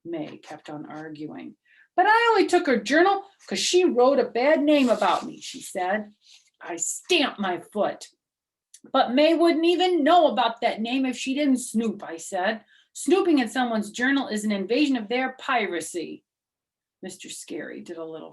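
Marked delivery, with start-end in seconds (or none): 1.9-5.4 high, loud, fast complaining; whiny protest. Acted. May defends herself to the teacher, fast and whiny. 8.9-16.2 put-on high, loud, indignant kid; heated argument. Junie argues with May and the teacher, loud and heated.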